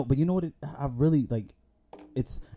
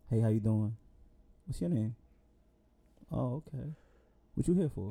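smooth ladies' man; 2 semitones lower, 5 dB quieter than usual. Shown as a demonstration. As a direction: play it cool and suave as you greet her.

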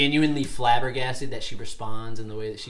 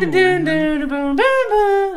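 high sung Windows chime; startup chime imitation; 7 dB louder, slower than usual. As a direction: sing the startup jingle up high. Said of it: "Like that".

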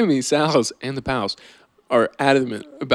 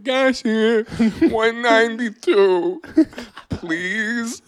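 high, slow little pitch; sign voice; 7 semitones higher, much slower than usual. Acted. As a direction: chirp it like a cheap yard-sale sign. Mock sign voice in skit.

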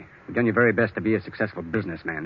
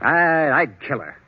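slow, louder, menacing threat; 6 semitones higher, 5 dB louder, slower than usual. Acted. Lawyer urging Henry to kill his wife, slow menace. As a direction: lean in and mean it slowly. Joe's murderous suggestion.

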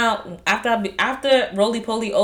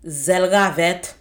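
"Zelgavet" drawn out slowly and loudly; nasty emphasis; 6 dB louder, much slower than usual. Shown as a demonstration. Quoting a Creole insult to friends, emphatically nasty. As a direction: lean hard on "Zelgavet" so it sounds like an insult. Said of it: "if you say it with the right, like, emphasis".

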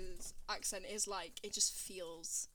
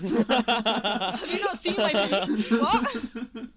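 very loud and slow, laughing; exasperated, half-laughing outburst; mock incredulous retort; 18 dB louder, much slower than usual. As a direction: throw it back in loud disbelief.

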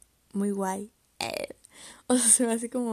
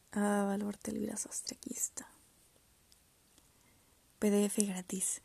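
whispered muttering; 4 dB quieter than usual. Real, not acted. Muttering while searching online.

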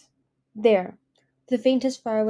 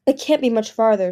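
desperate; 2 semitones higher, 7 dB louder than usual. Acted. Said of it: "she thought desperately".

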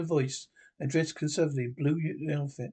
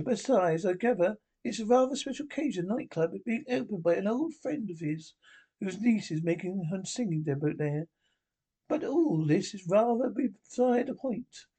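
high, prim and imperious; a much higher, haughty Iron Lady voice; Margaret Thatcher impression; 5 semitones higher than usual. Prime Minister scolding the Doctor, high and prim.

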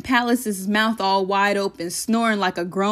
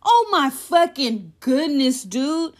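slow disbelief; 5 semitones higher, slower than usual. Acted. Disbelief at robbed house.

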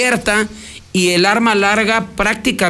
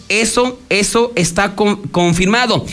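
usual speaking voice

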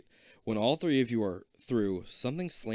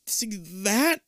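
shaken, halting, higher stammer; 10 semitones higher than usual. Acted. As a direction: stammer in shock. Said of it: "you stammer".

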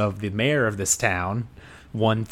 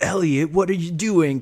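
high, louder scolding call; scolding friend; 8 semitones higher, 4 dB louder than usual. Retold or imitated. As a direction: call him out in exasperation. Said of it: "like, a, ... conversation".

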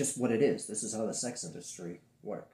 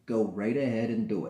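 ordinary delivery

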